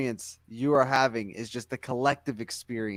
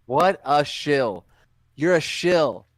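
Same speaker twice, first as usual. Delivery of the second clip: mocking; 3 semitones higher, 7 dB louder than usual. Real, not acted. Mocking chant at Tyson.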